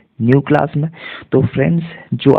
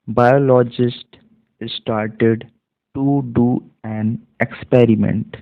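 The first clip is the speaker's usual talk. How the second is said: slow reading demo; much slower than usual. Shown as a demonstration. Teacher reading slowly word by word to students. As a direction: read each word out slowly, one by one. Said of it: "पढ़ करके मैं बताता हूँ".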